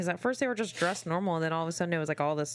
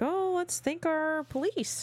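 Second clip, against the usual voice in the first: mock sweet; 11 semitones higher, much slower than usual. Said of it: "they're like".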